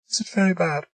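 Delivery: a hint of Margaret, worried; lightly voiced woman.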